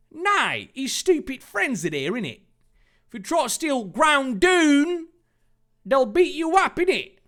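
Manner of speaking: high squeaky cockney voice; chirpy London villain, high-pitched; cockney accent. Acted.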